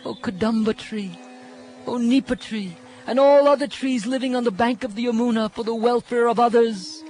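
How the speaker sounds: pleading gopis. Acted. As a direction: beg every tree as if your beloved is lost. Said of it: "Speaking as the gopis, he said".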